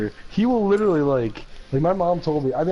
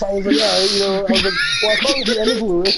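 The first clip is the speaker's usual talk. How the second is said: 1 excited gloating; 5 semitones higher, 5 dB louder than usual. Real, not acted. Gloating over winning the hand.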